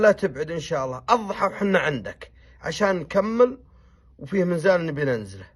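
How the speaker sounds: firm instruction. Retold or imitated. Sheikh instructing his men on the journey, loud and firm. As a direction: give firm orders to the camp.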